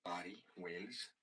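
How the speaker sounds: soft, tentative Welsh lilt; failed go at a Welsh accent. Shown as a demonstration. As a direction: try Welsh, quietly and unsurely. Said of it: "I can't do it".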